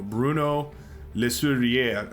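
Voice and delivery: slow, careful French try at Sourier; attempted French name. Shown as a demonstration. Commentator tries a French player name, carefully. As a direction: sound out Le Sourier the French way, slowly. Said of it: "so do I roll the R on that?".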